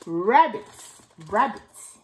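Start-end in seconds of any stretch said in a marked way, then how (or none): none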